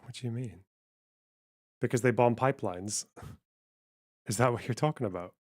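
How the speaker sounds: ordinary talk, nothing marked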